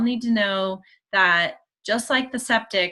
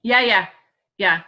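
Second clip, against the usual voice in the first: ordinary talk, nothing marked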